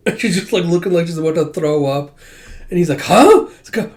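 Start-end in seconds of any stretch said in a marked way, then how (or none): 3.0-3.5 high, loud questioning yelp; startled huh. Acted. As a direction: blurt it in acted surprise. He reacts to her queasy look.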